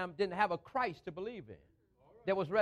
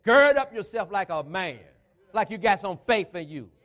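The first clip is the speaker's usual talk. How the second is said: forceful preaching; 6 dB louder than usual. Real, not acted. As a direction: punch out key words loudly.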